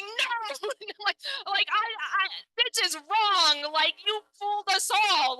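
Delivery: mock outrage. Comic scolds the kneeling man for fooling everyone, high indignant. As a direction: plead it like you were betrayed. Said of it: "And I'm like".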